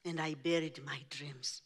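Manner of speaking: whispered, barely voiced. Real, not acted. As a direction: let it drop to a whisper. Hushed moment of burying dreams.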